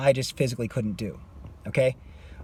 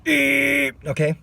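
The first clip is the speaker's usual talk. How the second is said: wrong placement demo; 11 semitones higher than usual. Shown as a demonstration. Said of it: "I was placing it right here".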